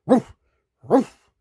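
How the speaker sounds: short sharp woofs; quick gruff barks; dog barking. A narrator's reading. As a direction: bark like a dog. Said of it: "The dog runs about barking".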